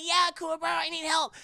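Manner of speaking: high, loud damsel plea; girly voice. Acted. Kiko begging Kuwabara for help in a high voice. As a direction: plead like a helpless girl.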